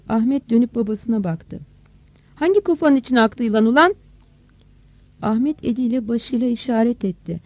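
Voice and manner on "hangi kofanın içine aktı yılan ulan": loud, higher demanding question; shouted question. Acted. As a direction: call the question over to him. Father shouts question to son. (bağırarak, sıkıştırarak sorma)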